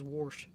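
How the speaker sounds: "warsh" with an r put in wash; boyfriend's pronunciation. Retold or imitated. Teasing boyfriend's word for wash, "warsh" with added r. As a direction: imitate "warsh", an r before the sh. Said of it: "'cause he says".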